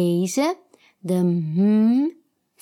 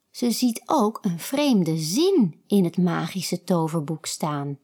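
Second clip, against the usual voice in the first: ordinary delivery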